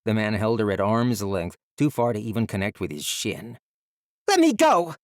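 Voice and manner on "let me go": much higher, louder desperate scream. A narrator's reading. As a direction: scream to break free. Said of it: "she screamed".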